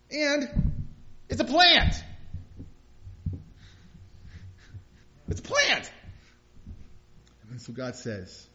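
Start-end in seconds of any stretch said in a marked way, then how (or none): 0.1-5.9 loud, much higher disbelieving cry; exasperated. Real, not acted. Preacher marvelling at Jonah's grief over a plant, incredulous and loud. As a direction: hammer the absurdity, incredulous.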